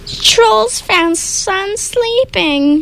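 high, slow gloating troll; troll shout. Acted. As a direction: drag it out like a dim troll. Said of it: "shouted one troll".

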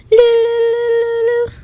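very high sung loo calls; loon call. A narrator's reading. A loon with head thrown back, high calls. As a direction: sing it like a loon. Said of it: "as he sang".

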